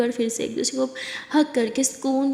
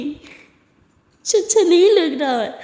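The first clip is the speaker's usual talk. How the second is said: hurt confession; 2 semitones higher, 4 dB louder, much slower than usual. Acted. Confessing hurt to her love.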